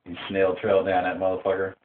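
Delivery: normal talking voice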